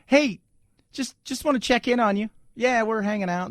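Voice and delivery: high, sugary fake-casual check-in; fake friendly. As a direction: sound too friendly. Agents voiced checking in.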